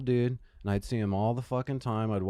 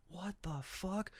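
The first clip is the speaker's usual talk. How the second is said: slow, soft, higher-pitched disbelief; hushed bewilderment; 8 semitones higher, 14 dB quieter, slower than usual. Retold or imitated. Storyteller replaying a reaction to the screamer outside, hushed bewilderment. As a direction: draw it out softly, baffled.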